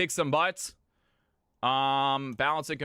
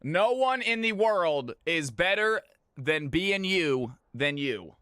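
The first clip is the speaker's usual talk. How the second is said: ordinary talk, nothing marked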